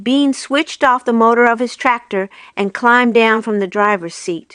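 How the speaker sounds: ordinary delivery, nothing marked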